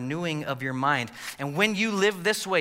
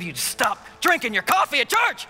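mock yell; 7 semitones higher, 3 dB louder than usual. Mock yelling at coffee guy.

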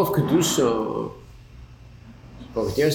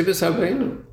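V'Sabbeinu pitched up high, rising at the end; stress on V'Sabbeinu; 5 semitones higher, slower than usual. Shown as a demonstration. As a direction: make V'Sabbeinu the point of the phrase. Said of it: "put the emphasis on the V'Sabbeinu".

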